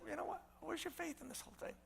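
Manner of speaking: hushed, almost tender challenge; soft rebuke. Acted. Jesus quietly asks his followers about their faith, hushed. Jesus softly questioning disciples.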